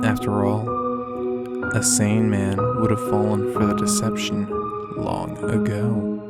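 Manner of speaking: usual speaking voice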